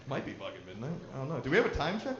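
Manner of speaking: ordinary talk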